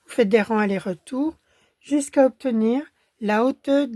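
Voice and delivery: ordinary talk, nothing marked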